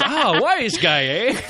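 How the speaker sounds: high, slow Scottish constable with a laugh; Scottish accent. Acted. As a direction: sneer it like a Scottish cop. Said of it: "a Scottish constable".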